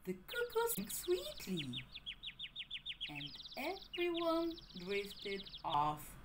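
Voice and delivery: soft, slowing bedtime close; drifting quiet. A narrator's reading. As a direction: fade softly into sleep. Story ending, falling asleep.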